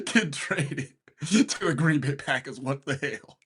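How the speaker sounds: high, helpless laughing through the words; cracking up in disbelief, pitch shooting up; crying with laughter. Real, not acted. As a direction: barely get it out for laughing. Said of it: "I almost crying with laughter".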